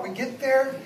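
usual speaking voice

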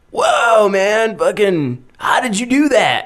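high-pitched retold astonishment; amazed. Drunk guy to the man who tricked him, amazed. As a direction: imitate a man stunned by a trick. Retelling a man's amazement at hack.

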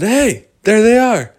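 loud, high excited call; excited discovery. Acted. Crew finding the two fish, loud and excited. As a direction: call out on spotting lost friends. Crew spot their lost friends.

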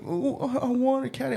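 high-pitched mock child's plea; imitated child. Child pleading in retold story.

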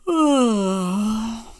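long voiced falling yawn. A narrator's reading. Mama hears the sleepy cub at night, long yawn. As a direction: yawn out a drawn-out "oh". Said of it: "Mama heard a yawn".

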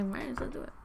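breathy unvoiced whisper. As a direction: whisper it like a secret. Retold reply to manager.

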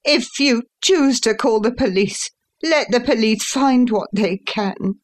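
high-pitched angry challenge; passionate defiance. A narrator's reading. Son daring father to call police, passionate defiance. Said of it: "with a passion".

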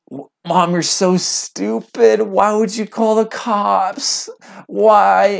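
high, drawn-out exasperated wail; retold teenage meltdown at mom; emotional outburst at mom. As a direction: stretch it out, pleading and furious. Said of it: "out of just all my emotions".

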